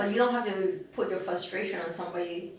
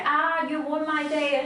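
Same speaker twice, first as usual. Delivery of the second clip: high, drawn-out mimicked whine; mock customer complaint; 7 semitones higher, 4 dB louder, slower than usual. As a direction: imitate an upset customer, slow and raised.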